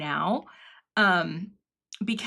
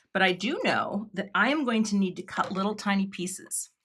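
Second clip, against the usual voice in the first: usual speaking voice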